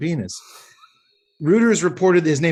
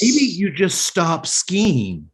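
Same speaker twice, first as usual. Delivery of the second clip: slow deadpan; 4 semitones higher, much slower than usual. Acted. Slow repeated advice.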